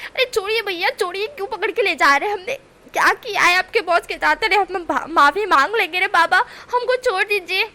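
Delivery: high, loud hammed-up pleading; dramatic pleading. Wing faking panic to guards, loud pleading. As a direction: overact begging to be let go. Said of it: "नाटक करते हुए".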